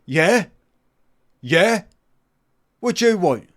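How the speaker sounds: loud, high, annoyed "what do you want"; gruff door answer. Retold or imitated. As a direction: bark at the visitors.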